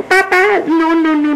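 put-on high, insistent little-girl call; excited child. A narrator's reading. Girl calling to her returning father, excited. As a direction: burst out high to get Dad's attention.

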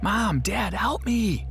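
put-on a captive boy screaming to his parents on the phone; screaming for help. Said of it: "I screamed".

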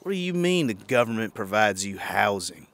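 ordinary talk, nothing marked

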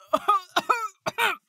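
high, loud put-on coughs; fake coughing fit. Disguised initiate excusing their odd voice, fake coughing. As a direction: cough theatrically to prove you're sick. Faking sickness to explain the voice.

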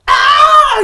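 very high, loud scream; demonstrated scream. Shown as a demonstration. Showing friends what screaming is, loud high shriek. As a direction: let out a piercing demo shriek. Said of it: "menjerit kan".

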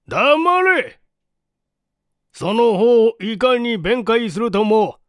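loud, high furious shout; angry scolding. Acted. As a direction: roar at the accused. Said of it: "こう叱りつけた".

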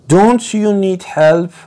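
high, slow worried asking; a storybook voice, lifted high with concern; concerned question. A narrator's reading. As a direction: ask as if truly worried for him. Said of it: "He asked".

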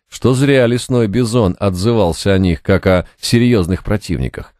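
ordinary delivery, nothing marked

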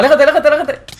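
high, loud, frantic warning; panicked shout. Real, not acted. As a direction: yell at your teammate to back off. Teammate in danger.

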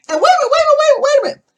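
breathless wait-a-minute burst; excited interjection. Real, not acted. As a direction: cut yourself off, worked up. Excited interruption of her story.

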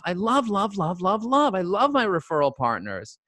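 high, gushing love. Real, not acted. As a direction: swoon over every love. Gushing about loved clients.

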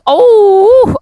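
hoarse voice breaking mid-word; voice cracking. Real, not acted. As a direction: voice cracks high and loud on oh. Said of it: "sesim de kusura bakmayın". (sesi çatallaşıp yükselerek kırılıyor)

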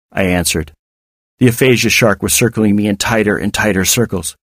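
ordinary delivery

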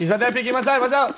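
urgent, high warning; being chased. Real, not acted. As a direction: call out the enemy fast. Enemy peeking during a firefight.

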